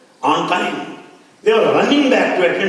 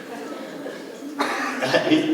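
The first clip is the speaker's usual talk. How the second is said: breathy, quiet laughing; soft laugh; 3 dB quieter than usual. Real, not acted. Speaker chuckling at the memory of Swami.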